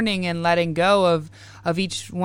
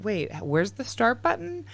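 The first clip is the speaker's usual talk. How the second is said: higher, softer mock confusion; confused self; 10 semitones higher, 5 dB quieter than usual. Host imitating herself hunting for the record button, mildly confused. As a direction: lightly act lost looking for the button. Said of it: "I'm like".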